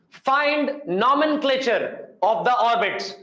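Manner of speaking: slow, spaced-out dictation; dictating. Real, not acted. Teacher reading out an orbit question for students to copy, slow dictation. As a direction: dictate in separate chunks for note-takers.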